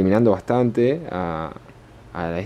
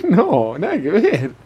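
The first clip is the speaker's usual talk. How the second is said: laughing; 12 semitones higher, 8 dB louder than usual. Real, not acted. Laughing at friend's wrong guess.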